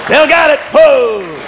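high, loud whoop of triumph; triumphant crowing. Real, not acted. Comic boasting he still got it, high loud crowing. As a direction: crow it to the crowd. Comic crows after a stage antic.

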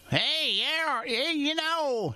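high, drawn-out Festus TV twang; Festus impression. Host recalls meeting Festus, slow high imitation. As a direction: ham up the Gunsmoke sidekick voice. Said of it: "he doesn't even talk like that".

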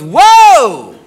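high, loud, drawn-out "whoa"; startled whoa. Retold or imitated. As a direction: act out the girlfriend's loud alarm. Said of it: "And she goes".

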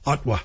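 "Ottawa" as two syllables, "Ot-wa"; dropped vowel in Ottawa. Shown as a demonstration. Host shows the local name, "Ottawa" said as "Ot-wa". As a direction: drop the middle vowel of "Ottawa". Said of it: "people leave out that extra vowel".

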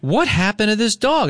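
high, alarmed outburst over the dog; panicked cry. Retold or imitated.

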